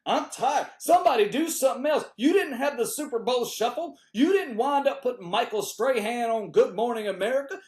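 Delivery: usual speaking voice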